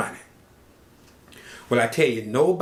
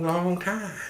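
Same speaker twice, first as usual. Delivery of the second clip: usual speaking voice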